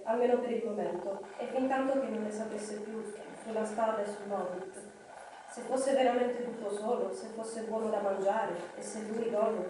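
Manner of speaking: ordinary delivery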